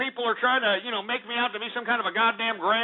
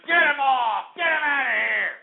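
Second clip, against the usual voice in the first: high, strained holler; yelled dismissal; 5 semitones higher than usual. Real, not acted. Host ordering the caller off the line, yelled dismissal. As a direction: holler to have someone thrown out.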